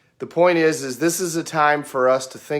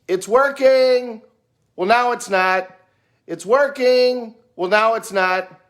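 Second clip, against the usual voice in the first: sister calling out; 8 semitones higher, 3 dB louder than usual. Retold or imitated. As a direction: call it back to the driver again and again, high. Sister calls blinker status.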